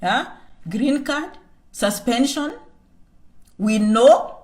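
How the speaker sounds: ordinary talk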